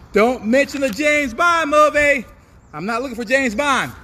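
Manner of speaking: high, loud telling-off; emphatic warning. Real, not acted. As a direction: warn them off firmly. Warning the class.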